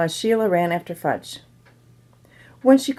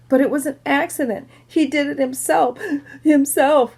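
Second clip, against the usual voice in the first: higher, mildly upset insistence; crying protest; 7 semitones higher than usual. A narrator's reading. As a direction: protest as if wrongly blamed. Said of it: "Sheila cried louder".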